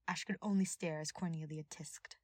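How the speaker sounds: ordinary delivery, nothing marked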